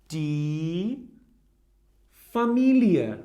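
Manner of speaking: Familie said fa-MEE-lee-uh, every letter read; German word said slowly. Shown as a demonstration. Teacher modelling Familie for learners, slow and clear. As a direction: sound out Familie clearly for the class. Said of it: "We read everything we see".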